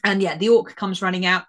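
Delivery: usual speaking voice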